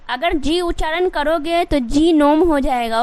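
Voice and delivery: ordinary talk, nothing marked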